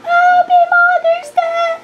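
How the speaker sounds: squeaky high Mother's Day wish; high-pitched greeting. Shown as a demonstration. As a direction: jump way up in pitch. Greeting repeated much higher. (mataas na boses na bati)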